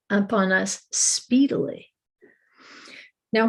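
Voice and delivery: ordinary talk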